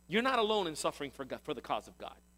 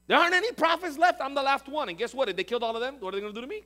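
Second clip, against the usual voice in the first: raised, loud, worked-up protest; agitated complaint; 7 semitones higher, 6 dB louder, faster than usual. Acted. Discouraged Elijah lamenting he is the last prophet, agitated complaint. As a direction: protest loudly, starting high and easing down.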